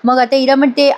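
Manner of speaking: ordinary delivery, nothing marked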